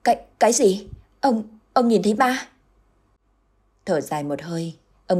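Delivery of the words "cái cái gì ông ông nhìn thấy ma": stammering, higher and louder in shock; frightened wife stammering at her husband, pitched up; trembling question. A narrator's reading. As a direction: stutter it out in fright. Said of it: "run run hỏi lại".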